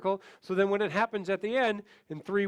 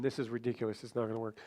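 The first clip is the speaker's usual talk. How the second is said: the doubting voice in your head; inner doubting voice; 8 semitones lower, 7 dB quieter than usual. Retold or imitated. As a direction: lower and much softer, a sceptical murmur. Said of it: "that little voice in your head".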